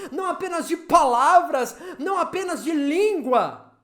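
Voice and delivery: impassioned preaching. Real, not acted. Preacher laments divided believing families, impassioned preaching. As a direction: press each clause home to the congregation.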